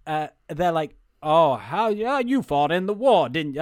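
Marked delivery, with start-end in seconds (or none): 1.2-3.6 put-on half-found Southern accent; shaky Southern accent. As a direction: try for a Southern accent and miss. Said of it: "where's my Southern accent?".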